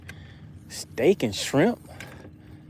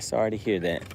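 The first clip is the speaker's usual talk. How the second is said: softer, lower sorry; sincere condolence; 5 semitones lower, 7 dB quieter than usual. Real, not acted. Streamer repeating his condolence to a widow, softer and sincere. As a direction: drop your voice and mean it.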